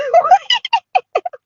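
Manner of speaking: high, loud burst of laughter; real laughter. Real, not acted. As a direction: crack up at yourself. Laughs at own love of praise.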